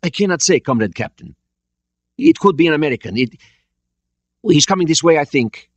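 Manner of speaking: tense report. A narrator's reading. Officer reports to captain.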